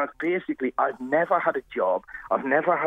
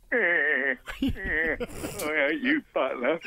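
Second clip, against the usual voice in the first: drawn-out catchphrase noise, lightly caricatured; comic character catchphrase; Blakey; slower than usual. Retold or imitated. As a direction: imitate a sitcom busman's stretched "eh". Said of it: "there was a character on there called Blakey, and he'd go".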